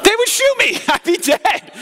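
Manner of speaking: high, laughing through the words; amused, giggling aside. Real, not acted. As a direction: still cracking up at the idea. Laughing after the mock yell.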